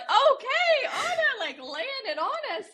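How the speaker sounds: high laughing squeak; laughing disbelief. Real, not acted. Recalling Anna's blunt reply, high laughing voice. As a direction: laugh through the words, caught off guard.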